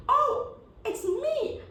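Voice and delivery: high, loud, laughing claim; shrill eager grab for the prize; squabbling child. Acted. One son arguing he deserves the light, high and loud. All sons argue.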